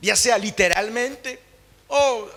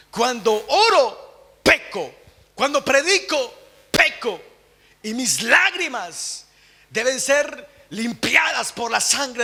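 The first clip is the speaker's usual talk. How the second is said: slow, louder solemn preaching of the quote; preached quote; 5 semitones higher, 4 dB louder, slower than usual. Retold or imitated. Preacher quoting Jonathan Edwards to the church, slow solemn proclamation. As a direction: proclaim Edwards' confession with weight. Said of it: "Él decía".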